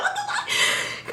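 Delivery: high, airy drawn-out mock freak-out; overwhelmed squeal. Fan reacting to his outfit, overwhelmed squeal. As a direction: squeak it out breathlessly through a laugh.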